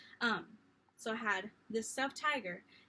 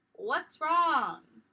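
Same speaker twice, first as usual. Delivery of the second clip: mom calling down the hall; loud call from a bedroom; shouted; 5 semitones higher, 9 dB louder than usual. Retold or imitated. Mom shouting from her room to her child. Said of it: "shouts out to you".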